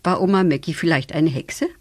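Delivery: ordinary delivery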